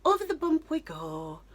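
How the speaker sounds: put-on high sing-song baby talk; sing-song to a child. Mum over the doorway bump, sing-song baby talk. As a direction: coo it like to a toddler. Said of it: "when she's like".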